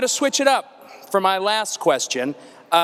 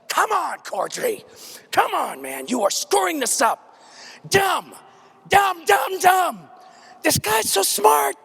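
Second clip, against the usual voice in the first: high, strained self-scolding yell; exasperated high-pitched rant at yourself; berating himself; 11 semitones higher than usual. Acted. Berating himself aloud on stage.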